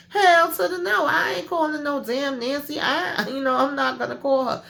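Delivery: hammed-up flat-out no; emphatic refusal. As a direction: squeal the refusal like it's outrageous. Voicing Richard refusing to call.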